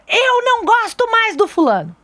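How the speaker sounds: put-on high, slow sulky kid; angry child. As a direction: pout it like an upset little boy. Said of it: "muito bravo". (criança emburrada imitada)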